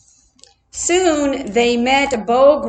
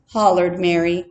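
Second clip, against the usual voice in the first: plain reading voice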